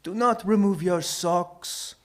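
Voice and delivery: voiced mock whisper, slow and deliberate; old shoemaker's warning. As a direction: caution slowly as an odd old shopkeeper. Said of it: "leans in close and whispers".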